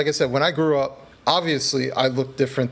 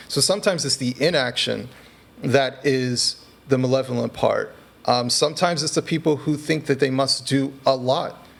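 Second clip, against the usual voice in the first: normal talking voice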